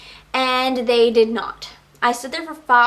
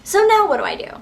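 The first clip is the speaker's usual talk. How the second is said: higher, louder exasperation; what now, fed up; exasperated; 8 semitones higher, 4 dB louder, faster than usual. Real, not acted. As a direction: throw up your hands. Exasperated after refusals.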